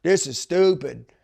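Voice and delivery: mock the griping, building to a drawn-out finish; mock complaining. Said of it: "I remember everybody being like".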